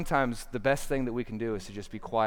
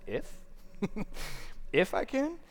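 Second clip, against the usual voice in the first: incredulous; 7 semitones higher, 6 dB quieter than usual. Acted. Jesus answers the father's doubt.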